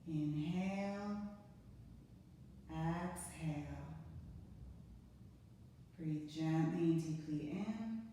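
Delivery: slow, soft, drawn-out breath cues; guided breathing cues. Acted. Yoga teacher counting a class through five breaths, slow and soft. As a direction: ease them through each breath, unhurried.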